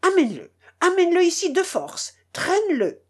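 high, fast furious orders; angry shout. A narrator's reading. Master stamping his foot, shouting orders at the servant, furious. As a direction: stamp and bark the commands at him. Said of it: "Mon oncle frappa du pied en criant".

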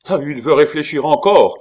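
loud, high outraged cry; shouted. A narrator's reading. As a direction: shout it in exasperation. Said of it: "cria Gorenflot".

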